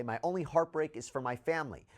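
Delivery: ordinary talk, nothing marked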